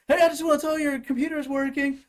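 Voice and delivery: high, loud mock phone customer; cheery caller. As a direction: imitate a caller proudly reporting in. Imitates an absurd happy caller.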